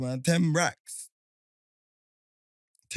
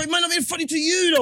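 high-pitched, raised-voice objection; exasperated protest; 11 semitones higher than usual. Real, not acted. Friend defending his joke in an argument, exasperated protest. Heated argument over whether it's funny.

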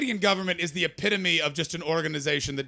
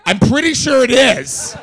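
louder, slow, laughing disbelief; incredulous laughing insistence; 8 semitones higher, 12 dB louder, slower than usual. Retold or imitated. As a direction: push back through a laugh, incredulous. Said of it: "And I was like".

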